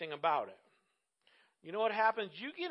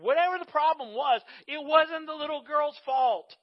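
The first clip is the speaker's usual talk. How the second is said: louder, higher, slowed insistence; 1 impassioned emphasis; 7 semitones higher, 10 dB louder than usual. Real, not acted. Preacher defending the little girl, loud and pleading.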